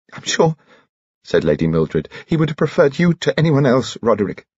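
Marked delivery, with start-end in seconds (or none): 0.1-0.6 high, grieving lady; tearful. A narrator's reading. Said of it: "a face streaming with tears". 2.3-4.4 high, grieving lady; tearful. A narrator's reading. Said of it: "a face streaming with tears".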